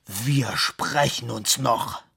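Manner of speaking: very slow, loud, menacing threat; forced out in anger. Acted. Director threatens Fabian, slow loud seething threat. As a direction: push each word out, seething. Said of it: "stieß er hervor".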